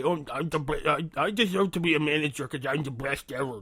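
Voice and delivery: lightly put-on bratty know-it-all voice; mocking impression of coworker. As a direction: mimic a self-important kid, making fun of him. Mocking the coworker's self-importance.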